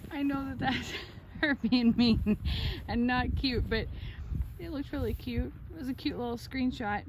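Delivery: ordinary talk, nothing marked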